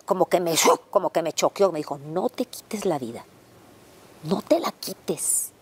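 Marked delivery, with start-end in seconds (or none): 2.0-5.5 mysterious voice. Retold or imitated. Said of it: "escucho una voz muy audible".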